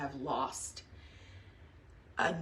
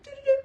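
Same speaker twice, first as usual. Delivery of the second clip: high, louder doo-doo-doo hum; idle humming; 7 dB louder, faster than usual. Retold or imitated. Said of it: "you might be like".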